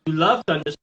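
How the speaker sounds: normal talking voice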